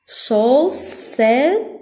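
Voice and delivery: "Chaussette" in three separate beats, chau, sse, tte; syllable by syllable. Shown as a demonstration. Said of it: "on a trois syllabes". (« Chaussette » découpé en trois syllabes)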